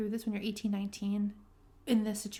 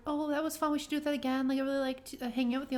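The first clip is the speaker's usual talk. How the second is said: higher-pitched mock gushing; eager date imitation; 4 semitones higher, faster than usual. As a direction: read an eager text in a raised voice. Voicing date's eager text message.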